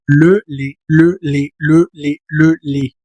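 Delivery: "le, le" repeated with a rounded "le"; "le, le" as a drill. Shown as a demonstration. Teacher drilling the rounded "le" sound. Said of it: "ahí mucho ojo".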